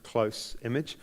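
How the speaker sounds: usual speaking voice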